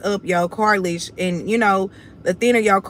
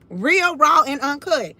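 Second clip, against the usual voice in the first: emphatic catchphrase; 5 semitones higher than usual. Real, not acted.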